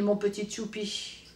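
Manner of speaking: usual speaking voice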